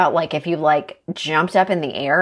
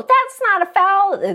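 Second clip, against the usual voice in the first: mock protester. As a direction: squeal it like a denying player. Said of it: "no one could go".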